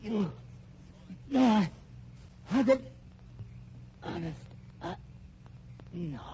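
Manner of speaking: broken, weak fragments; dying gasps. Acted. Shot man dying, broken faltering words. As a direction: fade out as life leaves.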